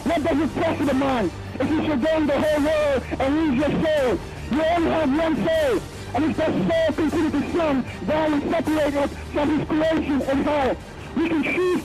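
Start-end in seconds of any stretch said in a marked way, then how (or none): none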